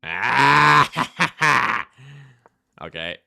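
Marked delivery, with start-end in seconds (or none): 0.0-1.9 loud high cry of alarm; sudden scream. Real, not acted. Player reacting to his game, loud scream.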